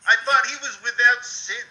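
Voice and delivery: high, wounded protest; mock devastated. Mock-devastated fan defending an idol.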